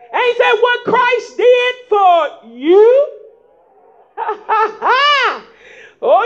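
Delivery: ordinary talk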